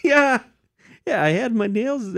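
put-on high-pitched, half-hearted New York accent; mock New York accent, high and playful; attempted New York accent. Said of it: "do a New York accent".

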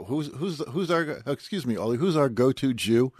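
mock-urgent call to a colleague; producers calling out. TV producer calls to Ollie for the go-to rabbi, calling out. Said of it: "they go".

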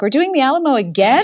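a parent's hammed-up groan of disbelief; mock incredulous complaint about homework; mock exasperation. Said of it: "I'm like".

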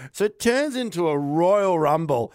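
usual speaking voice

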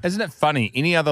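normal talking voice

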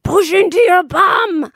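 high, slow, drawn-out coaching call; midwife's urging. Retold or imitated. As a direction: imitate a midwife urging a push. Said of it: "the midwife's literally saying to me".

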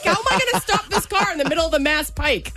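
high-pitched mock-panicked outburst; retold panic. Driver reacting to a stop-car warning, retold panic. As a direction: act out a panicked, rising wail of a question.

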